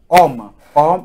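Homme said "om", no h; silent h. Shown as a demonstration. Teacher showing silent letter, Homme as "om". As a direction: drop the h of homme, "om". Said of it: "here it is silent".

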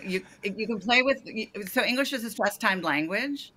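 ordinary talk, nothing marked

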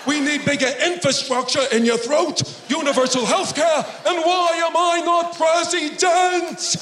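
high, hectoring stump-speech shout; Bernie Sanders. Acted. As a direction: rant like an indignant campaigner, stretching the last question. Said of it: "my brain sounds like Bernie Sanders".

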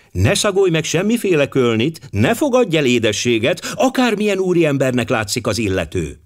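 a mild mock of a nagging cook; imitating the cook. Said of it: "Így mondta mindig". (a szobalány enyhén utánozza a szakácsnő intelmét)

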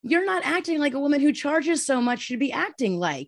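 high-pitched, exasperated comeback; mocking retort. Patient turning therapist's words back on her, mocking. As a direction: throw the remark back, incredulous.

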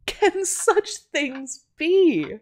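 high, very slow, drawn-out amazement; astonished cry. A narrator's reading. Miss Archer hearing Natty's story, astonished cry. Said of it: "cried Miss Archer".

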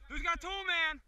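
loud high call; shouting. Real, not acted. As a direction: yell the question across the field. Calling out coverage on field.

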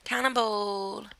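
slow cheer with a crack in it. Real, not acted. Cheering on a dive, voice cracking. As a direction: let the voice crack as you cheer. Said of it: "my voice cracked then".

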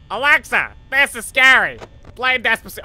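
high, loud mock cry for help; scared joke shout. Real, not acted. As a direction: yell at a smart speaker in fright. Mock command while scared.

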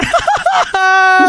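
high-pitched giggling fit; high bursting laugh. Acted. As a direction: crack up at a goofy sight. Laughs at the goofy dog.

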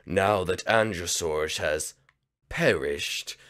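low, slow gloating villain voice; villain narrator. Acted. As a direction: gloat to the audience like a scheming villain. Villain addresses the audience.

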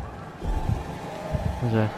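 hushed, slow, breathy question; whispered. Real, not acted. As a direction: whisper it, scared to be heard. Whispered repeat.

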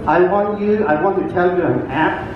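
ordinary delivery

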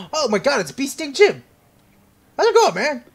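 high, loud delighted hail; excited greeting. Pretend. Player spots Bee Sting Jim, excited greeting. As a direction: hail a buddy you didn't expect.

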